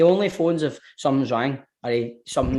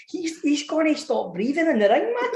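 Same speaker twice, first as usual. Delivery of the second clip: laughing; 11 semitones higher, 4 dB quieter than usual. Retold or imitated. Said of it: "I was like".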